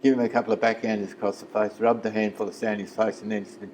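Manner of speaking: ordinary talk, nothing marked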